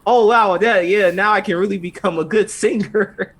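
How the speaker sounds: hammed-up delight at her new voice; mock thrilled girl. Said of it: "And then she's like".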